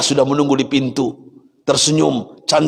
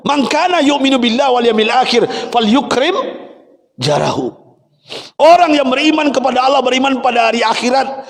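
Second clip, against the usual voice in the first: high, fervent preaching cry; rising sermon fervour; impassioned preaching; 8 semitones higher than usual. Acted. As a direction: preach it at full force, climbing high. Preacher quoting hadith fervently.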